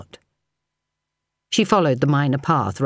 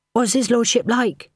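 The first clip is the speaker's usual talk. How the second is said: put-on higher, gently coaxing young maid; maid's voice; 9 semitones higher than usual. A narrator's reading. Dory asks William about his lordship, higher and coaxing. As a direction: draw him out with an eager question.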